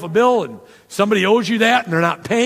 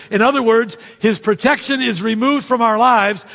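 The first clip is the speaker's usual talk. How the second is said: raised, urgent sermon voice; forceful preaching; 5 semitones higher than usual. Real, not acted. Pastor warning the congregation about unforgiveness, urgent and raised. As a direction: press the warning home.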